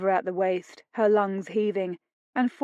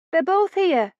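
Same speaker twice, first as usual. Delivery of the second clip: higher, calling from off; maid calling out; 10 semitones higher, 5 dB louder than usual. A narrator's reading. Maid to her lord, high call. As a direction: call it through the doorway. Maid calls from next room.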